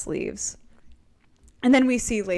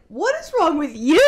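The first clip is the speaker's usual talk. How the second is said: higher, indignant echo with a laugh; hammed-up indignation, pitched up; mock offended retort; 6 semitones higher, 4 dB louder than usual. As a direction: throw it straight back, playfully affronted. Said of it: "I'm so offended".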